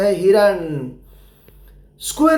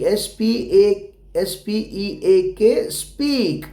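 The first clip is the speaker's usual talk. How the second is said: slow spelling; much slower than usual. Shown as a demonstration. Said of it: "spelling aloud to pupils".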